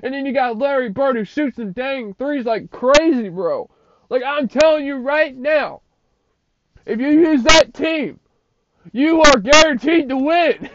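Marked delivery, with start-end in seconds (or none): 1.2-10.7 loud, high hype promise; excited. Real, not acted. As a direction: sell it like a sure bet. Insisting the team wins.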